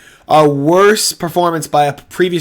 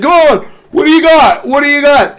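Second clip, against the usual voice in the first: higher and louder goading; mock taunt; 10 semitones higher, 6 dB louder than usual. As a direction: call him on like a video game fighter. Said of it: "He's like".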